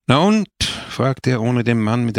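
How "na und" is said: sharp high "Na und?" thrown out loud; curt challenge. A narrator's reading. Priest impatiently challenging Pepone by lamplight, sharp and loud. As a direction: demand it impatiently, pitched well up. Priest confronting late visitor.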